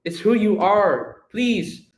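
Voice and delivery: urgent appeal, rising into a plea; pleading. Acted. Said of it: "And Alexis is pleading".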